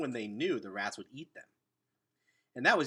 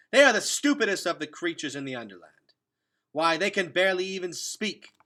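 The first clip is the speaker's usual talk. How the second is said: loud, higher, contemptuous tirade; angry scornful outburst; 7 semitones higher, 12 dB louder than usual. Acted. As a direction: sneer it out loud and high. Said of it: "Henry broke in angrily".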